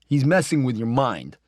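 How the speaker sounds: lightly voiced kid, sure of himself; Billy's voice. A narrator's reading. Friend Billy warning the narrator, lightly voiced boy.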